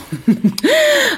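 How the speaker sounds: high, loud burst of laughter; sudden high-pitched laugh. Real, not acted. Laughs after quoting her son.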